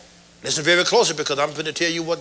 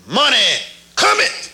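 second proclamation; 5 semitones higher, 4 dB louder than usual. Retold or imitated. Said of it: "And I said the second time".

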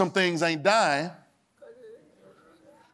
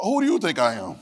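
usual speaking voice

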